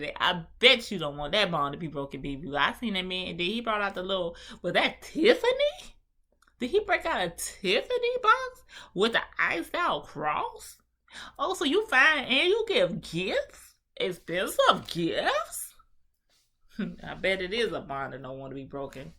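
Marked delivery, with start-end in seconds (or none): 4.6-15.7 much higher and louder, gasping at the gift; excited disbelief. Real, not acted. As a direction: squeal it like you just spotted a Tiffany box. Spotting the Tiffany gift.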